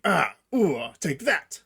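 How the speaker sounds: combat grunts. Shown as a demonstration. Showing what an NPC says when attacking, combat grunts. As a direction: grunt as if landing blows in a game fight. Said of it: "they'll make a combat grunt".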